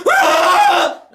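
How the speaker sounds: shrill imitated scream of agony; anguished scream. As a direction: scream in pain like the anime villain. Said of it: "but he's like".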